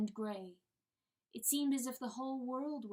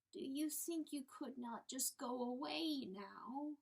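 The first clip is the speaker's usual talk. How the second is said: higher, slow, pleading hen voice; timid little hen; 4 semitones higher, slower than usual. Acted. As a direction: play the humble little hen begging. Hen voice continues.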